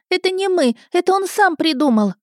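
put-on very high boyish protest; squealing. A narrator's reading. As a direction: squeal an excuse to dodge blame. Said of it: "заверещал парнишка в картузе". (визгливое оправдание мальчишки)

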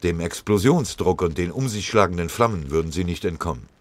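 plain reading voice

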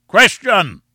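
high, loud bellowed interjection; booming shout. A narrator's reading. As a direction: boom it out to stop the speaker. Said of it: "boomed the voice once more".

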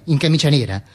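ordinary delivery, nothing marked

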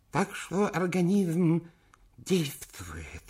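put-on high, slow toothless old man; toothless mumble. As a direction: mumble through no teeth. Old chairman voiced.

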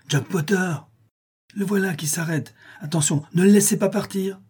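urgent, high-pitched warning cries; crowd crying out. A narrator's reading. Onlookers warning that Potter is here, crying out. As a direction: raise the pitch sharply, like calling the alarm. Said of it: "des voix crièrent".

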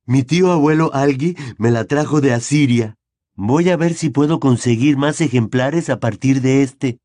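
lightly voiced keen schoolboy; eager boy voice. A narrator's reading. Neville keeps talking eagerly.

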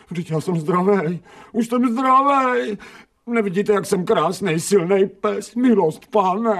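high, frantic begging to be spared; frightened pleading. Acted. Dog begging the surgeons to spare him, frightened and pleading.

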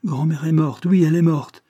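high, loud distressed cry; anguished insistence, pitched high; wailing lament. A narrator's reading. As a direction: wail the news like a desperate child. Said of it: "gémit Chad".

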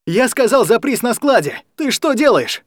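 high frantic shouting; panicked yell. A narrator's reading. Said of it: "в панике орал Алекс".

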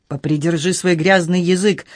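usual speaking voice

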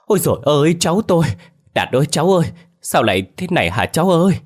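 high, loud grieving wail. A narrator's reading. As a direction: wail over the stiff grandchild. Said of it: "rú lên".

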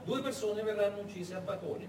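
ordinary delivery

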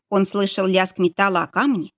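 usual speaking voice

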